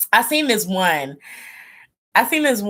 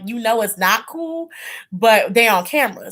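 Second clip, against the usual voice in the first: ordinary talk, nothing marked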